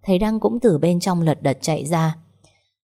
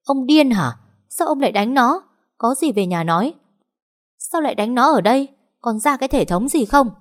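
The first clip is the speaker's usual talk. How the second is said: high, indignant acted outburst; yelled scolding; 7 semitones higher than usual. A narrator's reading. Mother restrains her husband in the hospital, scolding with a yell. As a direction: scold him in a raised, high pitch. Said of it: "gào lên".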